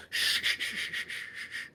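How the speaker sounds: long string of fading shushes; urgent shushing. Acted. Hidden man hushes the players in a cave, urgent shushing.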